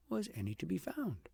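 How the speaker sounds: plain reading voice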